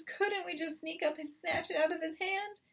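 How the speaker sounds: put-on high childlike cub voice; small fox. Cub asking father to snatch chicken, high. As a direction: ask eagerly like a little cub.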